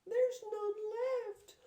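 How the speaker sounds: very high, slow, tearful cub wail; Baby Bear crying. Acted. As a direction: whimper it like a heartbroken cub. Baby Bear upset, porridge gone.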